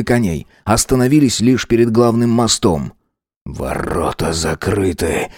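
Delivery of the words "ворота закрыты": hoarse, slow and softer; after breathing tube. A narrator's reading. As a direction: rasp it out hoarsely, slowly. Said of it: "прохрипел Шамиё". (хриплым голосом, медленно и тише)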